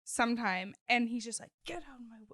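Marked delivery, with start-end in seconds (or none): 1.7-2.3 much softer mock menace. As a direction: say it under your voice, imitating a brush-off. Acting out an actor's curt line.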